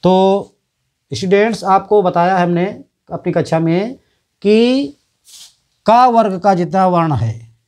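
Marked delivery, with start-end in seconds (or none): none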